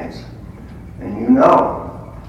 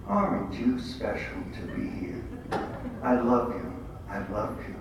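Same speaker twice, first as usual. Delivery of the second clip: soft, lower and gentle; tender Jesus; 6 semitones lower, 9 dB quieter than usual. Retold or imitated. Jesus to him in adoration, soft and tender. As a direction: murmur it like a loving whisper to a child.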